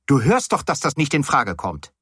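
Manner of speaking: high, agitated protest; erregtes Schreien. A narrator's reading. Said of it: "schrie ich". (aufgebrachter Protest mit hoher Stimme)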